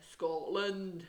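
a hammed-up Scottish accent on Scotland; bad Scottish accent. Shown as a demonstration.

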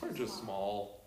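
soft, slow and low; humble plea. Retold or imitated. Preacher voices Amos pleading Israel is small, softly. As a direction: shrink your voice, humble. Voicing Amos's defense.

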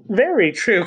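high, lively narrated outcry; crying out. A narrator's reading. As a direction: cry it out in eager agreement, lightly acted. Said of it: "cried Miss".

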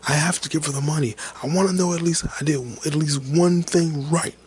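high-pitched, mock-desperate plea; retold tearful outburst. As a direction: plead it, imitating someone crying and yelling. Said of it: "he's crying and screaming like".

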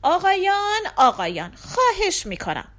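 high-pitched appeal for order; pleading interjection. A narrator's reading. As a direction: cut in and plead for calm. Father cuts in on quarrel.

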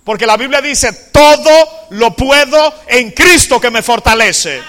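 loud, slow, driving sermon delivery; loud climactic preaching; full-force preaching. Acted. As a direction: preach it out to the whole church.

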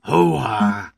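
big drawn-out catchphrase bellow; loud, hammed-up actor impression; Al Pacino. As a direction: belt out each exclamation with gusto. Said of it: "Al Pacino".

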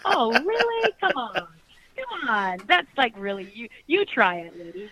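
mock-indignant comeback, pitch leaping up on oh really; sarcastic retort. Answering a harsh critic, scoffing and mock-indignant. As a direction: tease the critic with a scoffing you try it, lady. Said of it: "it's like".

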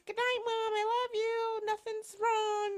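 panicking daughter faking calm for her mom; fake cheerful goodnight. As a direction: hide the panic behind a bright sing-song. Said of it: "because I'm a really good actor".

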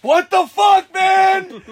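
loud, high retold panic; storyteller acting out shocked yelling; freaking out. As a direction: yell it in comic alarm. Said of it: "I'm freaking out".

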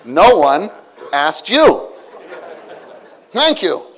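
loud mock retort; a loud pretend put-down; mock rebuff. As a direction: snap it back, half joking. Preacher voicing rebuff.

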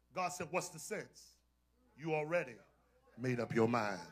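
soft sermon in spaced-out phrases; hushed preaching. Real, not acted. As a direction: bring it down quiet and pause between thoughts.

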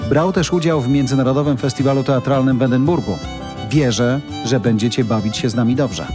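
polished announcer read over music; radio intro read. Acted.

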